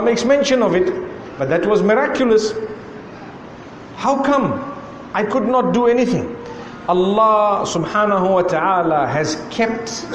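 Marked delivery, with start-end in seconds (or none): none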